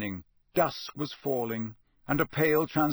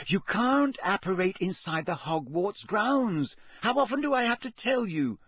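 exasperated, rising higher to the end; high, fed-up know-it-all; impatient; 7 semitones higher than usual. A narrator's reading. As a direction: scold a friend who never listens. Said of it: "said Hermione impatiently".

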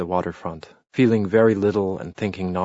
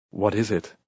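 a touch of anxious worry. A narrator's reading. Husband to grim caretaker at the door, mildly anxious. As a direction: ask it uneasily, fearing bad news. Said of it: "he asked anxiously".

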